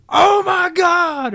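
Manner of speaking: very high, long, loud mock scream; mock horrified scream. Imagining brother discovering the smudge, mock horror. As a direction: wail it out in hammed-up horror.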